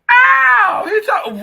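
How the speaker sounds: very high loud yelp; sudden shout. Real, not acted. A sudden painful yelp, very high and loud. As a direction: yelp out sharply.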